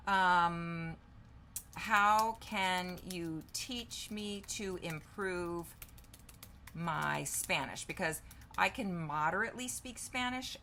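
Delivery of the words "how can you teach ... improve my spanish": slow, drawn-out words; spaced out at typing speed; dictating while typing. Real, not acted. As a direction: say it slowly as you type it. Typing a question while saying it.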